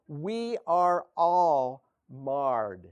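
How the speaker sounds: very slow, weighty pronouncement, word by word; solemn proclamation. Real, not acted. Preacher declaring the word of the Lord to the congregation, slow proclamation. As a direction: spell it out slowly as a verdict on everyone.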